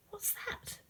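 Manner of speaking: hushed, wary question; whisper. Acted. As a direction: whisper at the odd noise. Hearing a strange sound.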